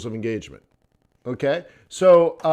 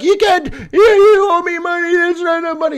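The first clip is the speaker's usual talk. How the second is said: much higher, louder mock protest; hammed-up yelling seller; mock upset vendor; 9 dB louder than usual. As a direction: squeal that she's owed money. Vendor yelling after him.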